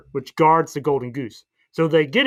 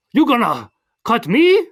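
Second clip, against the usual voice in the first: high, slow, loud mock Puss in Boots accent; 7 semitones higher, 5 dB louder, slower than usual. Puss challenging the masked cat, in a put-on accent. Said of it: "you're doing a great accent".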